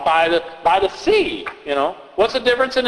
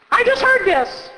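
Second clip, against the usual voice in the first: put-on high kid voice complaining; a bored little kid; child voice. Child tells the reader it's old news, high kid voice.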